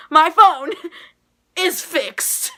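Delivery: thrilled shout. Retold or imitated. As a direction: shout the good news through a laugh. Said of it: "I flipped".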